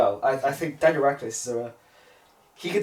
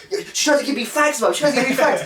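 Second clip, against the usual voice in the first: much higher, louder whiny tattle to Mum; tattling kid; 11 semitones higher, 6 dB louder, faster than usual. Retold or imitated. Teen actor tells his mum the girl offered cigarettes, whiny and high. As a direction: whine like a kid telling on someone. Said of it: "over her and goes".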